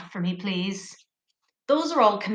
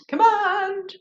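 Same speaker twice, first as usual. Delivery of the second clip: high modelled word; 9 semitones higher than usual. Shown as a demonstration. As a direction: Command said high and bright for repeating. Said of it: "Say command".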